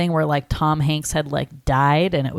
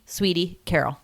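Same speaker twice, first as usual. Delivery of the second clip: usual speaking voice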